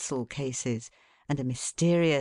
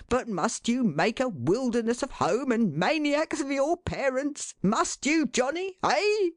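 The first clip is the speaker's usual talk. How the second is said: pitched much higher, climbing to a squeaky peak; mock outrage rising on each question; exasperated father; 11 semitones higher than usual. A narrator's reading. Father pressing his son with questions.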